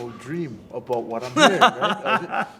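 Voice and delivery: cracking up as he tells it; real laughter breaking in; laughing. Real, not acted. Laughing at his funny story.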